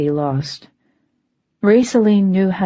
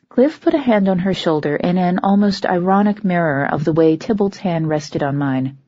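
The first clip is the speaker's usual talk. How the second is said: plain reading voice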